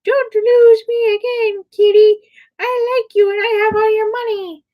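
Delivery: very high squeaky put-on voice; slow, drawn-out high character voice for an object; talking wallet. Prankster behind Kitty speaks as her wallet, squeaky teasing.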